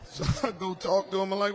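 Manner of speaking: usual speaking voice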